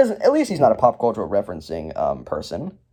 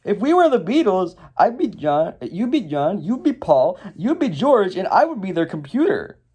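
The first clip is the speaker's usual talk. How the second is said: Zane; 6 semitones higher than usual. Acted. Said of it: "Zane is like".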